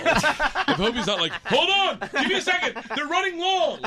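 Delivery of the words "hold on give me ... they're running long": high, raised comic yell; exaggerated shout to someone waiting; mock calling out. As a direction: holler it over your shoulder, hammed up. Imitates Obama calling out to others.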